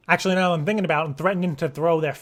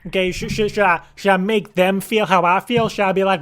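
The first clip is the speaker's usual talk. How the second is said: normal talking voice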